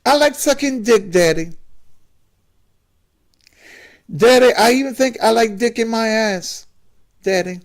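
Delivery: very high, halting son, sigh included; a son about to come out to his father, very high voice; son's high voice. Acted. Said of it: "why are you talking like that".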